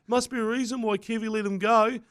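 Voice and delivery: high, smug commentator voice; snooty commentator impression; mocking Blocker Roach. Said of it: "He's like".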